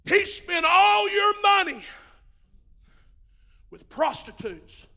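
resentful complaint with rising shouts; preacher acting out the older brother's angry grievance to his father. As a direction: whine it out like a jealous son who worked for nothing. Older brother's accusation, shouted then slowed.

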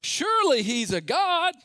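high, loud, slow mocking call; taunting. Elijah taunting the prophets, loud and slow. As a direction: draw it out to taunt them. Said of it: "Elijah began to taunt them".

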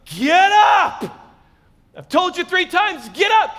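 loud, high exasperated shout; angry dad yelling. Acted. Angry dad getting son up for school, yelling. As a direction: yell it through the bedroom door. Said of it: "an angry dad who's trying to get their son out of bed".

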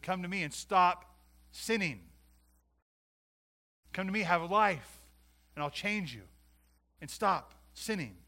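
loud, slow, pleading call; Jesus calling out. Retold or imitated. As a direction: call them home slowly and loudly. Jesus' call quoted in sermon.

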